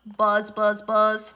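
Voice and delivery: loud bee buzz. Acted. Bee sound in story.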